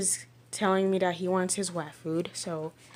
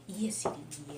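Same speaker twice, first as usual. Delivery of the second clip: whispering; 3 semitones lower, 9 dB quieter than usual. Real, not acted. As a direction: breathe it to the dog, unvoiced. Cooing at the dogs.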